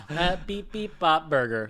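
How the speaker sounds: high playful beep-bop machine noises; robot beeping. Pretend. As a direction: chirp it like a cheerful burger robot. Machine making a burger joke.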